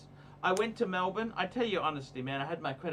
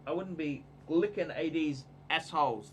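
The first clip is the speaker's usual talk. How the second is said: ordinary delivery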